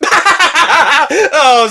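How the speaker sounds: loud, high burst of laughter; laughing. Pretend. Villain machine cracking up at its own lie, laughing. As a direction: break up laughing mid-line. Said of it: "I can't say that without laughing".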